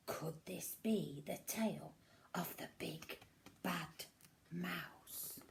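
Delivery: put-on soft whisper building suspense for a child; whispered storytelling suspense; suspenseful whisper. A narrator's reading. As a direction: hush them, is it the mouse.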